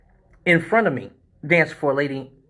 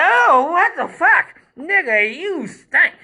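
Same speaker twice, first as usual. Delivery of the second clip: high-pitched, drawn-out disgusted outcry; mock disgust; 14 semitones higher, 5 dB louder, much slower than usual. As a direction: imitate a woman appalled by a stench. Imitates woman disgusted by smell.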